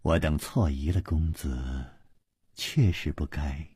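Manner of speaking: soft, slow apology; apologetic sigh. A narrator's reading. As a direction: apologise quietly and slowly after a sigh. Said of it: "王妃又叹了一口气".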